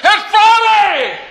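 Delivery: high, drawn-out preacher's shout; top-of-voice shout. Retold or imitated. Old pastor finishing his fired-up sermon, full-voiced shout. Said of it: "he shouted at the top of his voice".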